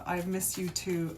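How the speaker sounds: ordinary talk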